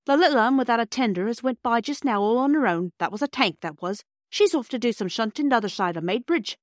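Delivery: put-on a proud cockney porter lecturing children. Porter Perks explaining engines to the children in a hearty Cockney accent.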